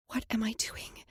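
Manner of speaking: soft anxious whisper. Acted. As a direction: breathe it to yourself. Inner panicked whisper.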